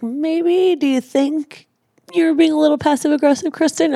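a sing-song mock of the gentle therapist. Wife imitating their therapist calling her out, high mock voice. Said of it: "He's like".

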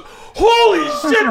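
slow, high, loud amazed cry; the crowd loses it; high loud mock crowd gasp; crowd going wild. Crowd reacts wildly.

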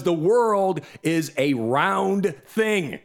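"round" stretched out, word by word; slow, pointed explanation. Real, not acted. Host explaining to a dimwit, slow and pointed. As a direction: spell it out slowly and deliberately.

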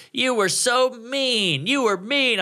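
high, drawn-out whine of accusation; hammed-up wounded protest; mock drunken complaint. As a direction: imitate a tipsy woman whining. Retold drunk heckled woman complaining.

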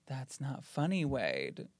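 a hint of a ghost whispering; soft ghostly voice. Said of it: "Do you hear the whisper kind of voice?".

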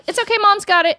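high, mock-cheerful martyr mom; sarcastic singsong. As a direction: feign cheerfulness while picking up after kids. Sarcastic announcement to her kids.

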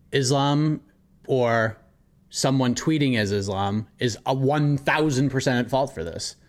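ordinary talk, nothing marked

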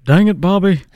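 King of the Hill impression. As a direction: do Hank Hill, on request. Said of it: "Do it again, [name]".

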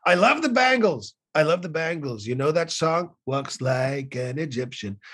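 slow drawn-out finish in a brash tycoon voice; Trump impression. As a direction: sell the line like a showman. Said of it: "he said".

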